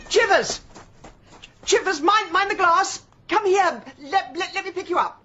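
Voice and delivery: urgent, high, loud warning; alarmed shout. Acted. Owner warning kitten away from broken glass, alarmed shouting. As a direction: shout in panic to protect a pet.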